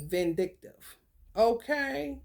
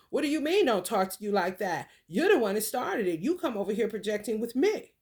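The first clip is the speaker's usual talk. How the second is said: ordinary talk, nothing marked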